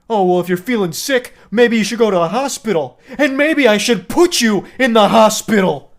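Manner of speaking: mock-indignant rant, rising in pitch and volume; Jacob. Jacob turning on sick Mike, offended and loud. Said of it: "Jacob gets really offended".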